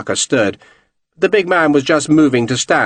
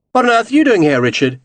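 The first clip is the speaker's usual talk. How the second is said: oddly cheerful; 9 semitones higher, faster than usual. A narrator's reading. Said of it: "He sounded oddly cheerful".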